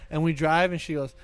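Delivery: ordinary talk, nothing marked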